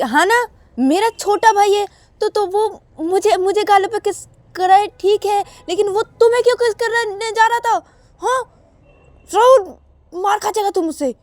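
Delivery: high, loud jealous threat; jealous angry sister. Acted. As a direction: warn him off sharply. Shruti warns, jealous.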